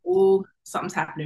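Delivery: high, quick burst of alarm; sudden alarm. Retold or imitated. Mother realizing labor is starting, high quick alarm. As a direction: blurt it out as labor suddenly kicks in.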